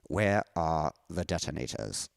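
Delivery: put-on slow, low German-accented villain; Die Hard villain. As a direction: draw the line out low like a movie villain. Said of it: "the line I wanted to say was from Die Hard".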